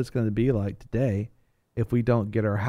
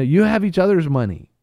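Jimmy Stewart pleading; 7 semitones higher, 8 dB louder than usual. Retold or imitated. Banker on the counter calming the crowd, raised pleading voice. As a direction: point and plead over the noise. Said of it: "It's like".

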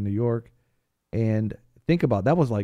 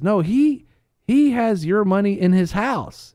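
raised pleading voice; 10 semitones higher, 7 dB louder, slower than usual. Retold or imitated. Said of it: "He says".

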